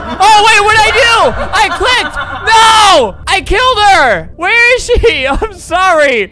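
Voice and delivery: high, loud frantic wailing; hammed-up horror and apology; mock panic. Player who has just killed Jojo by clicking, mock panicked shouting.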